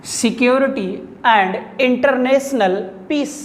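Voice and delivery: slow, spaced-out reading for note-takers; slow dictation. Shown as a demonstration. Teacher repeating an English title for students to copy, slow dictation. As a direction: dictate it slowly, separating each word.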